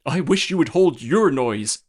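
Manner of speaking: put-on loud, rude snap at the boy; rude retort. A narrator's reading. Nurse rudely hushes the singing boy. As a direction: cut him off rudely. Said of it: "said the nurse rudely".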